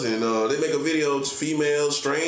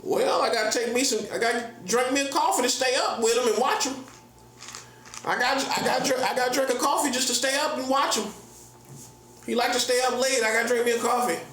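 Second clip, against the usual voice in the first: put-on high-pitched old-timer struggling to keep up; hammed-up old head, high and teasing; mock old person; 9 semitones higher than usual. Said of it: "even the old heads can't keep up".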